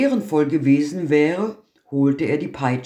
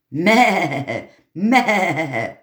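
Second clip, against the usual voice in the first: higher bleating meh meh; goat bleat; 3 semitones higher than usual. Acted. The goat bleats its complaint to the tailor, a mock goat bleat.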